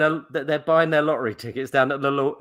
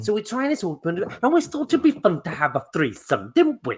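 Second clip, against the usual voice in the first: mock Welsh girl, much higher at the end; Welsh accent; 5 semitones higher than usual. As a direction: put on a chirpy Welsh girl. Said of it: "that's the best accent you've ever done".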